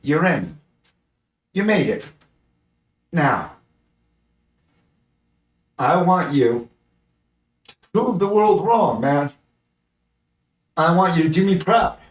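beam with pride as you tell him he made it; proud counselor. Retold or imitated. Counselor telling young Jerry he got into college, proudly lifted voice.

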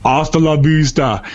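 catchphrase in a fake Austrian accent; Arnold Schwarzenegger. Finishing the shout-out, badly faked Austrian accent. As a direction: sign off with a hammy Austrian accent. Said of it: "worst Schwarzenegger accent ever".

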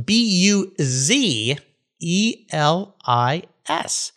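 slow letter-by-letter spelling of Buzelis. Shown as a demonstration. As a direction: spell each letter of Buzelis slowly and clearly. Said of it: "So I'm speaking Lithuanian today".

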